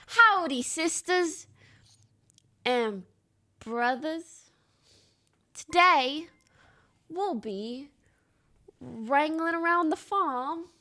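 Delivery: loud, hammed-up Southern cowboy drawl; cowboy accent. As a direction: play a farmhand greeting the chat. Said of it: "How do you do a Caicon accent?".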